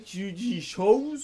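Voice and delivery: ordinary talk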